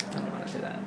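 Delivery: soft unvoiced whisper. Real, not acted. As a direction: barely whisper the refusal. Quiet protest about a rude remark.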